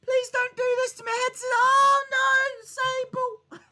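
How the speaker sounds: high mock-whimpering plea; Mero's voice. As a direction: whine it like a beaten wrestler begging. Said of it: "He's gonna be like".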